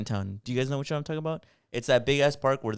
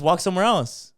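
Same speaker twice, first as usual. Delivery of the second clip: raised-pitch suggestion to back off; retold retort; 7 semitones higher, 4 dB louder than usual. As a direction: tell her off, lightly acted. Acted retort to the complaining lady.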